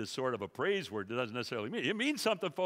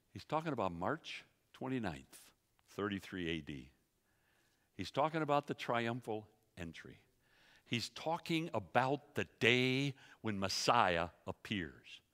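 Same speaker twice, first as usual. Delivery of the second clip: usual speaking voice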